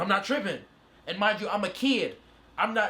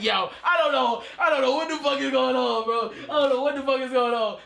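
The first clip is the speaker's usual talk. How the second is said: higher, louder, laughing half-shout; giddy confusion; 6 semitones higher, 4 dB louder than usual. Retold or imitated. Reliving the moment he met the group, giddy laughing confusion. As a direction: blurt it out, overwhelmed and thrilled. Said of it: "I'm like".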